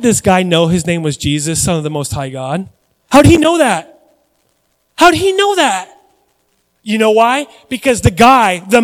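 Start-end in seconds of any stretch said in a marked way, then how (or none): 3.1-4.0 emphatic question. Real, not acted. Preacher repeats question, building. 5.0-5.9 how did he know that, pitched high and loud with disbelief; rising astonished preacher's cry; incredulous cry. Real, not acted. Preacher repeats question, building.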